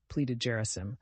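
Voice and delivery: ordinary delivery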